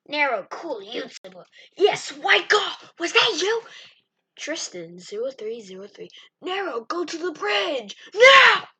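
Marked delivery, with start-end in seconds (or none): 1.8-3.6 excited shout. Real, not acted. 6.4-8.7 high loud yelled command; shouting order. Real, not acted. Ordering friend in game.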